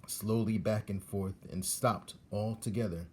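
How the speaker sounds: ordinary delivery, nothing marked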